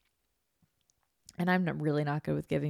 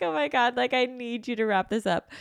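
dramatic plea; 6 semitones higher, 4 dB louder than usual. Retold or imitated. Pleading with nurse.

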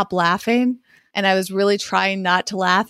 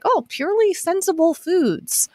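lightly done Midwestern man voice, a bit higher; a hint of a folksy Midwestern guy reading a tagline; Jason voice; 9 semitones higher than usual. Host imitating her co-host's Midwestern voice, lightly done. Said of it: "your classic Jason voice".

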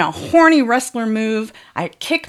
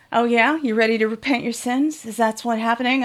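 ordinary delivery

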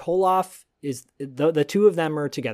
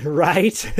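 usual speaking voice

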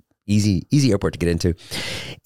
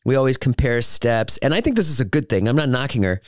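normal talking voice

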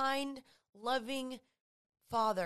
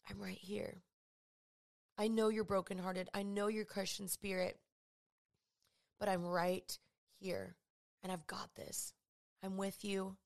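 tender comfort; 3 semitones lower, 5 dB quieter than usual. Acted. Jesus comforting the heartbroken person, hushed and tender. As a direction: hold them close with your voice, softly.

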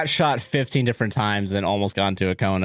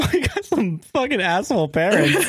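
laughing outrage; 7 semitones higher than usual. Real, not acted.

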